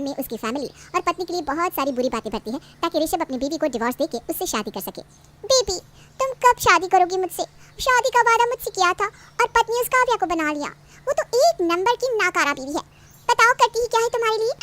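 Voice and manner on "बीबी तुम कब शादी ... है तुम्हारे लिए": higher, wheedling lover's voice; coaxing mistress. Acted. Mistress sweet-talking him to marry her. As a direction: coax him into leaving his wife.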